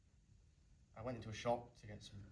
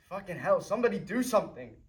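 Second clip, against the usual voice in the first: louder, higher cry for help; desperate shout; 8 semitones higher, 14 dB louder than usual. Acted. Brother calling for help over his stabbed brother, shouting. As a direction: scream for anyone to help. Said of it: "I was shouting".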